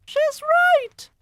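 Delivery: very high, loud witch cry. Acted. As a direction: screech in agreement. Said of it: "cried another voice".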